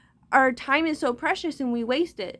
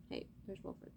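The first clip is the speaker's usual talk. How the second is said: unvoiced whisper; whispered aside; 21 dB quieter than usual. Real, not acted.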